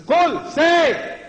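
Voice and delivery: high, loud, punched-out order; militant command. Shown as a demonstration. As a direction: fire it out as a divine command. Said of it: "This is how Allah talks".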